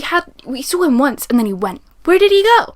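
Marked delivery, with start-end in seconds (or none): 2.0-2.7 high, loud, baffled question; exasperated outburst. Real, not acted. Reviewer baffled that a character vanished, loud exasperated question. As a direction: throw the question out in disbelief.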